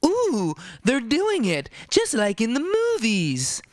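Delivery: high, thrilled gasp of a newcomer; wide-eyed delight at a movie moment; a tourist thrilled by a subway pitch; starstruck tourist. Retold or imitated. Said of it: "and you're like".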